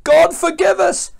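very high, loud plea; acted frantic shout; sailors' pleading cry. A narrator's reading. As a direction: cry out desperately for mercy. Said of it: "the sailors cried".

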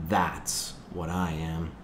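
slow, soft musing; quiet self-decision. A narrator's reading. Jonas settles on the word for his feeling, musing softly. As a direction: say it slowly and softly to yourself. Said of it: "Jonas decided".

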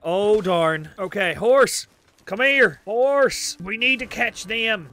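loud, high alarmed exclamations; panicked shouting. Real, not acted. Gamer chasing witnesses on horseback, panicked shouting.

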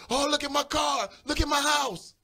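high-pitched mimicked wailing over possessions; high, fussy imitation of materialistic people; mock lament. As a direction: complain high, as if fretting over belongings. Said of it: "we go in there and we say".

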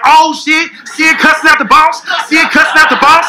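excited coworkers. Retold or imitated. Coworkers hyping him up to curse the boss, excited. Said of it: "all the other employees like".

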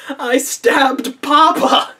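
high, loud, drawn-out mock lament; hammed-up wail. Daughter having just stabbed her father, mock wailing. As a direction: imitate a distraught daughter, stretched and melodramatic.

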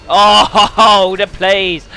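loud, high whoop; real burst of triumph; excited celebration. Real, not acted. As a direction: crow loudly over your own play. Celebrating a kill.

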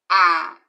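"A" held open and loud; sounded vowel. Shown as a demonstration. Teacher modelling the vowel, loud. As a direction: sound "A" clearly, wide open. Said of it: "preste bem atenção no som da letra".

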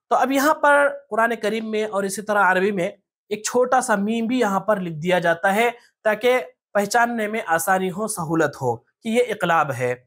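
ordinary talk, nothing marked

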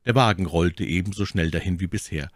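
plain reading voice

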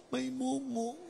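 high, soft mock whimper; acted tearful squeak; crying in fear. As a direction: whimper it like a scared crybaby. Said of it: "Ba't ka umiiyak?".

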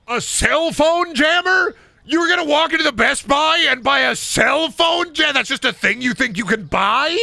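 shrill incredulous outburst at the customer; exasperated shout. Real, not acted. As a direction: yell it in total disbelief. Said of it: "exasperated rant at customer".

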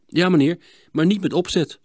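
lightly voiced boy answering politely; boy's voice. (jongensstem, hoger)

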